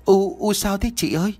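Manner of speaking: high, tearful cry; a little girl bursting into tears; crying shriek. Acted. Little sister crying to her older sister, tearful. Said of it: "khóc ré lên".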